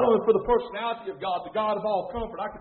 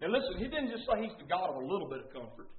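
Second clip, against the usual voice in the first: ordinary talk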